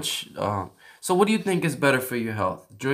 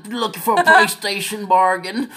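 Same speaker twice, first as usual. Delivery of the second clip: loud, high, hammed-up American patriot; mock American; 6 semitones higher, 6 dB louder than usual. Acting out an American bargain-hunting driver, loud mock American voice. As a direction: holler it like a caricature American. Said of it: "of course, in America".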